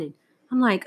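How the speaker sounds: ordinary talk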